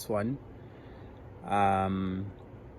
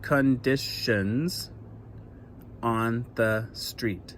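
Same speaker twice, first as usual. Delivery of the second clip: slow syllable reading; slower than usual. Shown as a demonstration. Teacher reading her poem line slowly for children. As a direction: read each syllable slowly and evenly. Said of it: "listen to the syllables as I read it".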